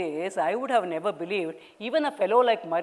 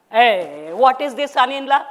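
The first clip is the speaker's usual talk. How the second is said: loud, higher scolding call; 4 semitones higher, 8 dB louder than usual. Acted. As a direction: chide the son-in-law. Mother scolds son-in-law.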